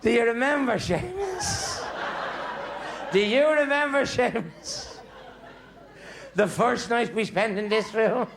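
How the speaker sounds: put-on high, teasing old woman, drawn out; wife recalls the wedding night to Seamus; coy old wife. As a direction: tease him like a sweet old wife. Old wife reminiscing to husband.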